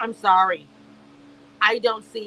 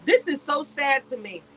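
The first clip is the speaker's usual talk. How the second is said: ordinary talk, nothing marked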